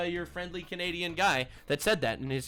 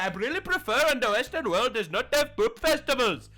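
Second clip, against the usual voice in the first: high, loud put-on fake Canadian accent; hammed-up mock "Canadian" accent; joke "Canadian" accent; 7 semitones higher, 6 dB louder than usual. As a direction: do an absurdly wrong Canadian accent. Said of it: "say this in my best Canadian accent".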